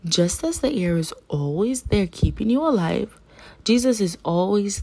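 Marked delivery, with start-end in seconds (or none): none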